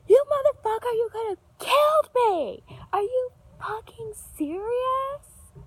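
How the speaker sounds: high, loud enraged yell at the driver; furious yell. Retold or imitated. Yelling at the driver who nearly hit her. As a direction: scream it at the old driver. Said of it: "I'm like".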